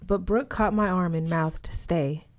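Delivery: ordinary delivery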